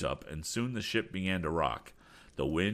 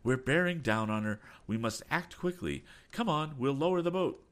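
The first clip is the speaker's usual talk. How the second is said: urgent, higher-pitched orders; raised voice rising to a rallying call; shouted urging; 9 semitones higher than usual. A narrator's reading. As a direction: hurry them to act. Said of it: "he shouted".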